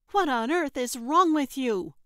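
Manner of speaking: high, loud, exasperated demand; glaring demand. Acted. Trixie glaring down at fallen Gertrude, loud angry demand. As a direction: glare down and bark the question. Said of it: "Trixie asked, glaring down at her".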